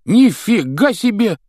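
high, loud and slow wow; amazed exclamation. Acted. Twins react to the news, loud amazed cry. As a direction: shout it out in amazement. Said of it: "воскликнули близнецы".

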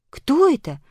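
high, wondering question; surprised. A narrator's reading. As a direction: ask in open surprise. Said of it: "удивилась Аля".

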